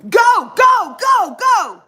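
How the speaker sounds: high, loud, repeated urgent yells; screaming getaway. Retold or imitated. Friend fleeing to the getaway car, screaming. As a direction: shout for the driver to floor it.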